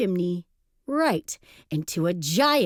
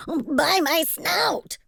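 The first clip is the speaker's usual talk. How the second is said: high, slow cry of alarm; startled cry; 8 semitones higher, slower than usual. A narrator's reading. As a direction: exclaim it in a high, drawn-out piggy voice. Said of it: "cried Horace".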